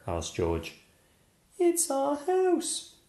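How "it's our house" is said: very high, slow squeak; squeaky mouse. Acted. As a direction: squeak like a tiny mother mouse. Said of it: "squeaked the mother mouse".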